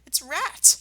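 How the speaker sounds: a startled gentle badger; Badger's voice. Badger surprised to find Rat here. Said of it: "said Badger".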